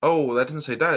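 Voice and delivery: ordinary delivery, nothing marked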